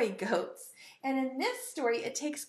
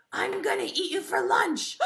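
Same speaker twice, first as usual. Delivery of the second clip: raised-pitch menacing troll threat; mean troll; 6 semitones higher, 4 dB louder than usual. Acted. Troll threatening the little goat, mean voice. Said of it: "The troll was so mean".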